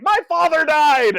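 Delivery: loud, high melodramatic cry; mock outraged wail. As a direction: wail it as a hammed-up film heroine. Retold outburst of film character.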